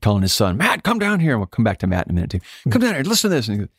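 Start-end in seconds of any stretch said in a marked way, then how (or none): 0.6-1.3 much higher mock calling out; a high, excited imitation of Dennis calling his son; imitating Dennis calling out. 2.7-3.5 much higher mock calling out; a high, excited imitation of Dennis calling his son; imitating Dennis calling out.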